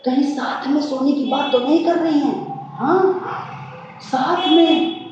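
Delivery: high-pitched, startled questioning; alarmed disbelief. Acted. Husband checking wife's shocking report, alarmed.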